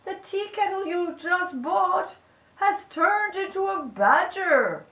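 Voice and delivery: high, urgent announcement; alarmed cry. A narrator's reading. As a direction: helper crying out astonishing news. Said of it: "cried the helpers to the priest".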